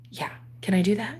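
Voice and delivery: hushed unvoiced whisper. Neighbour offering free food, whispered. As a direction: whisper it like a secret.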